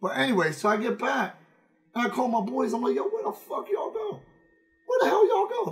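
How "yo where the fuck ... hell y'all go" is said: much higher, frantic demand; exasperated yell. Retold or imitated. Phoning the friends who left him, high exasperated demand. Angry call to his friends.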